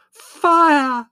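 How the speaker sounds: very high, very loud cry of alarm; piercing scream. A narrator's reading. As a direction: scream it right in his ear. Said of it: "screamed Margot in his ear".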